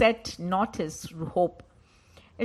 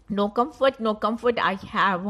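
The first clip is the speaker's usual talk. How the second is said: loud lament; 3 semitones higher, 9 dB louder than usual. Acted. Climax of the lament.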